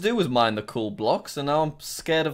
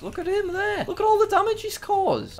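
much higher, squeaky mock voice; mock squeak. Gamer mocking a lava monster in a high squeaky voice.

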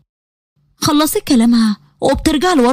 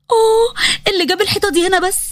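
startled; 5 semitones higher than usual. Acted. Huda hits the wall.

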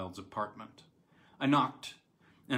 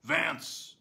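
a raised call with a rising end; loud call; 6 semitones higher than usual. A narrator's reading. Wilde calls his servant from the dark passage, loud call. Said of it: "called out in a loud voice".

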